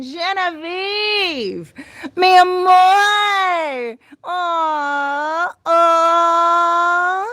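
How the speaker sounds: slow adoring coo; gushing. Real, not acted. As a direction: coo it like a fond aunt. Gushing over a viewer.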